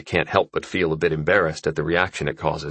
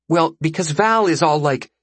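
put-on high-pitched girl explaining awkwardly; high, awkward teenage explanation; self-conscious girl; 9 semitones higher than usual. Voices Gilly's message.

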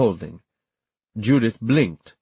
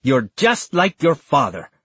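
angry, higher-pitched accusation; biting, contemptuous jab; snarl; 6 semitones higher, 3 dB louder than usual. A narrator's reading. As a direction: snarl it as an insult. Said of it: "snarled Antonia".

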